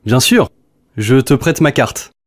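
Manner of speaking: normal talking voice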